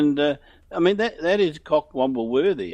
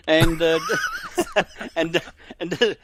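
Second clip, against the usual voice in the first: laughing; 2 semitones higher, slower than usual. Real, not acted. Laughing mid-sentence.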